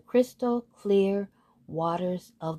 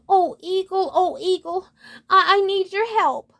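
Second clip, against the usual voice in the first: very high, louder call for help; urgent plea; 11 semitones higher, 5 dB louder than usual. A narrator's reading. Unicorn waking her friend the eagle, pleading urgently. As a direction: call out in storybook distress.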